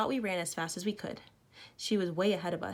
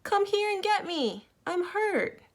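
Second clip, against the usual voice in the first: put-on much higher, slow small voice of a hurt girl; tiny far voice; 13 semitones higher, 5 dB louder, slower than usual. A narrator's reading. Trapped girl calls up from a cave, tiny voice. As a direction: sound small and far away. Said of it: "we heard a tiny voice".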